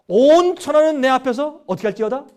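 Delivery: loud, high proclaiming voice; booming scripture pronouncement; divine proclamation. Acted. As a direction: proclaim it over the whole earth. Quoting God's command.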